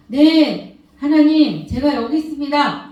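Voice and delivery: loud, ringing reply to God; eager answer to God. Retold or imitated. Preacher voicing her answer to God, ringing and loud. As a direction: call out your answer to God, ready to serve.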